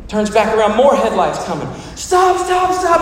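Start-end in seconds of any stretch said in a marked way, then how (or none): 1.9-3.0 rapid repeated high-pitched warning cries; acted frantic shout. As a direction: shout to halt the oncoming cars. Acted man flagging down more cars.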